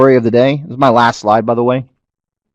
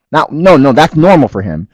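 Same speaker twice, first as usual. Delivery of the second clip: usual speaking voice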